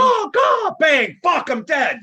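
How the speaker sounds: high, loud shouted quote from The Crow; shouted movie quote. Retold or imitated. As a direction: yell the line like the film scene. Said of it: "The Crow".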